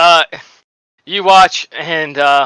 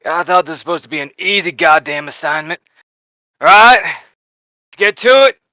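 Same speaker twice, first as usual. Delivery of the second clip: lightly voiced sergeant, building louder; grumbling sergeant; 4 dB louder than usual. Sergeant griping about the assignment, lightly voiced, then rallying. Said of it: "you see the sergeant goes".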